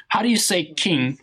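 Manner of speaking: ordinary talk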